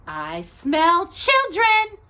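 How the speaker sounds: high, loud, slow witch relish; greedy witch voice. A narrator's reading. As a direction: savour it like a hungry witch. Witch sniffing out children.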